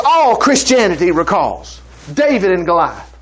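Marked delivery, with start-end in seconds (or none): none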